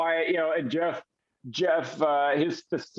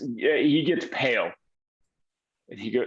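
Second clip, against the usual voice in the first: ordinary talk, nothing marked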